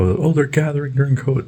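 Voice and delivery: high tattling busybody voice; high mock gossip. Mocking nosy busybody.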